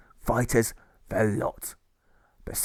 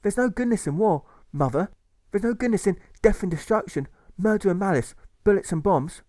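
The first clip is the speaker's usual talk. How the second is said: higher, earnest protest; with real zest; 10 semitones higher, 4 dB louder than usual. Acted. Alfred answering his mother with zest. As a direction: stand up to her with conviction. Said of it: "speaks out with real zest".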